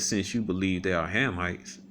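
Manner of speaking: ordinary delivery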